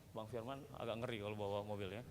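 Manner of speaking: ordinary talk, nothing marked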